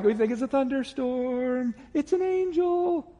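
lightly voiced puzzled bystanders; confused crowd. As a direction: play the baffled onlookers, drawing out "angel".

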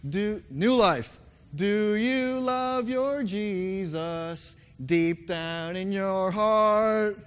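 ordinary talk

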